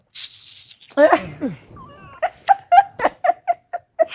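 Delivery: long, loud, high-pitched laughter; hammed-up peal of high laughter; mother's mocking laugh. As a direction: burst into a prolonged imitation cackle. Acts out mother's reaction to name.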